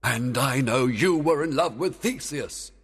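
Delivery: king's forceful counter-accusation; retorting Oberon. A narrator's reading. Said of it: "Oberon told her".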